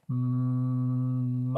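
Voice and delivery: MOP stretched slow, long hummed m into long ah; elongated sounds. Shown as a demonstration. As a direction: hold the m and ah of MOP, then a quick p. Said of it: "say the word elongating the sounds like this".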